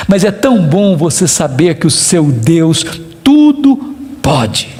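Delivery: low emphatic drop. Acted. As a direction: sink the voice down on "pode". Preacher drops low on "pode.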